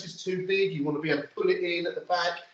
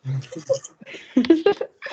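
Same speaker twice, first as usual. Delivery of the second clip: high breathy burst of laughter; breathless laugh; 13 semitones higher, 5 dB louder than usual. Real, not acted.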